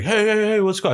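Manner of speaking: high-pitched mock calling out; imitated concerned neighbor. Neighbors walking over to the commotion, mock calling out. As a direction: imitate a neighbor breaking things up, pitched well up.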